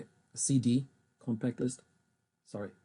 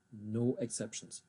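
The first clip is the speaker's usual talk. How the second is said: ordinary delivery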